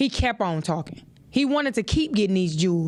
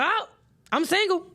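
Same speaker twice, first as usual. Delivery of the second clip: lightly voiced, much higher giddy single person; mock carefree single; 8 semitones higher, faster than usual. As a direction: tease the giddy single crowd. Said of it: "don't get out here and be like".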